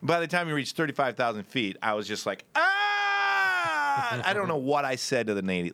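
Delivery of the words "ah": put-on long high drunken "ah"; high drunken yell. Hammered on the plane, a long high drunken yell.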